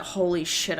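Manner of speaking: ordinary talk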